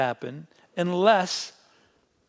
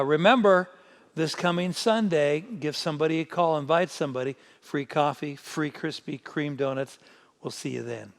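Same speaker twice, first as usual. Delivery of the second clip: normal talking voice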